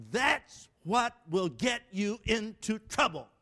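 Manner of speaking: "that's what will get you into trouble" slow and loud, each word punched high; emphatic word-by-word. Real, not acted. Preacher warns the young people of trouble, each word punched loudly. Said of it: "and emphasize it".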